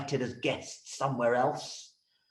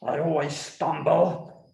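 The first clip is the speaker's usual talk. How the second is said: plain reading voice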